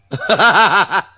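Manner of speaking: loud, high burst of laughter. Real, not acted. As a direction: break into a big laugh. Laughs at own story.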